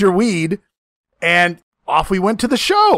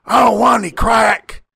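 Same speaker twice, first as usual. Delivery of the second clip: put-on drawling refusal, a bit raised; Tommy; 3 semitones higher than usual. Friend mimicking the wrestler refusing the dealer, put-on voice. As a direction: imitate the wrestler turning it down. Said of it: "And you say".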